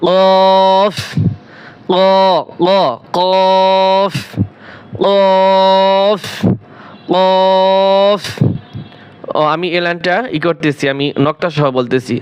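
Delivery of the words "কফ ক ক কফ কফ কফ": কফ held long from the back of the throat; drawn-out letter. Shown as a demonstration. Teacher drilling students on a long কফ. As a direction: stretch কফ out for three beats. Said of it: "এখানেও তিন আলিফ টান হবে।".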